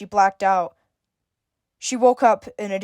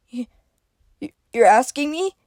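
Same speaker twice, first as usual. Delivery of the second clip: stammering; 5 semitones higher than usual. A narrator's reading. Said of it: "Izzy stammered".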